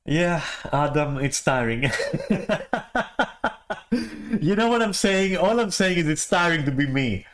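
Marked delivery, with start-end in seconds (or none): 1.9-4.3 sudden high-pitched laugh. Real, not acted. Laughing at own tiredness. 4.4-7.3 laughing. Real, not acted. Laughing at own tiredness.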